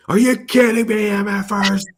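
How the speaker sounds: high, loud outraged movie-star impression; mock-furious yell; Samuel L. Jackson. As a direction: shout it in hammed-up disbelief. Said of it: "Samuel L. Jackson".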